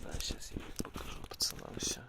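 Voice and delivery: whispered aside. Real, not acted. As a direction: whisper it to the camera. Showing the camp quietly.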